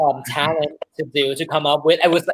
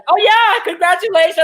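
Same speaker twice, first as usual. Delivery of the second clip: very high, loud cheer; hammed-up imitation of an elated friend; excited congratulating; 4 dB louder than usual. Imitating Aria's celebration.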